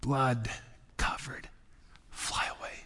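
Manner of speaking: soft awed whisper; hushed wonder. Real, not acted. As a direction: let the words fall to a whisper. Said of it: "I'm doing a lot of whispering today".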